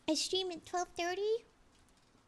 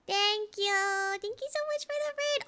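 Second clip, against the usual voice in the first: excited thanks; 6 semitones higher, 5 dB louder than usual. Real, not acted. Thanking raider.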